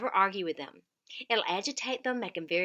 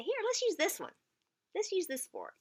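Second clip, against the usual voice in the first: gentle redirect; 10 semitones higher than usual. Shown as a demonstration. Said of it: "Just distract them and say".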